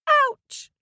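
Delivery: very high, loud cry of pain; boy's ouch. Acted. Boy lands with a bump, yelping in pain. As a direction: yelp it like a hurt child. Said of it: "said the boy".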